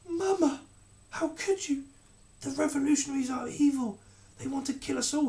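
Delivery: put-on high, loud young boy's cry; boy exclaiming. A narrator's reading. Prince to his mother the queen, high hurt exclamation. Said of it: "he exclaimed".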